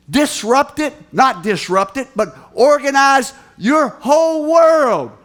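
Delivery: loud, high, drawn-out sermon cadence; preaching at full force. Acted. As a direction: hammer each phrase to the congregation. Quoted brothers, preached loudly.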